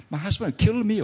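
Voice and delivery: high, loud, alarmed cry; quoting a frightened wife, high and loud. Retold or imitated. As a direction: blurt it out like she's scared of him. Quoted wife fearing angry husband.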